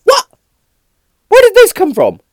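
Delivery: very high, loud astonished squeal; retelling his own shock at dad's order; shrieked what, very high and loud; stunned disbelief. Retold or imitated. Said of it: "retold shocked reaction".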